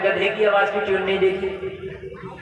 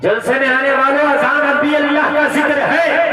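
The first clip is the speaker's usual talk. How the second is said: raised, drawn-out call to the crowd; high-energy preacher's call; 4 semitones higher, 4 dB louder than usual. Real, not acted. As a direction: proclaim it to the whole gathering, stretching the end. Preacher calls out to the gathering.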